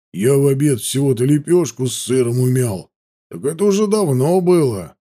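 lightly voiced soldier grumble; a hint of a hungry, complaining trooper; grumbling guard. A narrator's reading. Hungry guard tells comrades about his lunch, grumbling.

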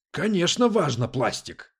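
loud, high burst of indignation; indignant retort, falling on the last word. A narrator's reading. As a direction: snap back, outraged. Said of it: "возмутился Дэвид".

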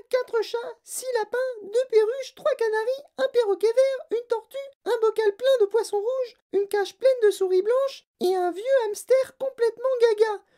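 very high, loud shrieked rant; spoilt girl's tantrum. A narrator's reading. Girl listing her pets to mother, shrieking tantrum. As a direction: whine and shriek the demand, slowing at the end.

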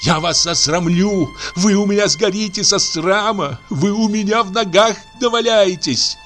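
high, raging cry; furious shout. Acted. Aksinya screaming about the land given away, furious. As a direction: hurl it at the family. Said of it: "кричала Аксинья".

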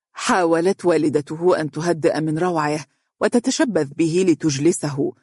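usual speaking voice

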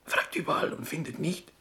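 dropped to a whisper after being shushed; softly whispered telling, careful not to be heard. Acted. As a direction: hush it right down.

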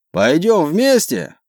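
high, slow called question; calling out. A narrator's reading. Captain asks his friend on the next ship, calling out. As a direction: stretch the question across the water.

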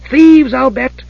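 high, eager kender voice; excited. A narrator's reading. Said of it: "said Tass excitedly".

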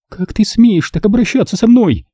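high, indignant outcry; furious exclamation. A narrator's reading. Nina strikes Rick and cries out furiously. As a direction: fling the outrage at him. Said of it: "В ярости воскликнула девушка".

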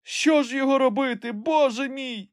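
very high-pitched wail of dismay; distressed lament. A narrator's reading. Master despairs over the rotten branch, distressed wail. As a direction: cry out as if all is lost. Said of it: "скрушно запитав Легран".

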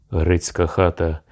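ordinary delivery

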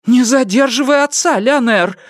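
high, cross mother's reproof; angry scolding. A narrator's reading. Grandmother telling boy to let father go, high angry scolding. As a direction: scold the child in a high, sharp voice. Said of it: "рассердилась матушка".

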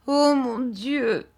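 high, slow, heartbroken plea to God; pitiful cry. Acted. Emily cries out to God under Rosa's cruelty, high and pleading. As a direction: let it break out in anguish. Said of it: "d'un ton qui aurait dû toucher le cœur".

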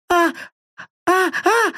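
high panicked shriek; terrified shriek. Acted. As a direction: shriek in pure terror. Screaming woman. (испуганный визг)

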